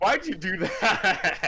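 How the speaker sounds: laughing through the question; giggling disbelief, slowed down. Real, not acted. Laughing at friend's outburst.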